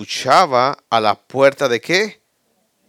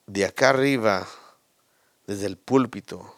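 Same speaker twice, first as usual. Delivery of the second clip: normal talking voice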